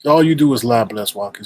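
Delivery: ordinary delivery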